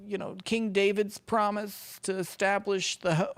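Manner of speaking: high, slow, hammed-up preacher voice, mocking the clip; squeaky mock-sermon voice; mocking pious voice.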